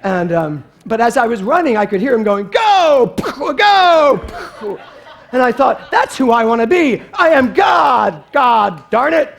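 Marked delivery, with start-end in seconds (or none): 2.5-4.8 high, loud mock battle cries; superhero shouts. Preacher imitates the boy shouting while playing. 5.9-9.3 loud, slow exultant declaration; triumphant shout. Pretend. Preacher shouts his joy at the boy's power.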